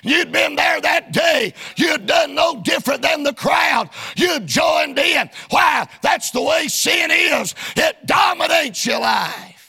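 forceful, rhythmic preaching. Real, not acted. Preacher warning listeners they'd have joined the crowd, preaching hard. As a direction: drive each phrase home from the pulpit.

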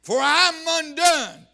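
loud, high, drawn-out wail of confession; crying out. Retold or imitated. Preacher voices prophet under conviction, crying out. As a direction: cry it out slowly with full force. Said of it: "I cried".

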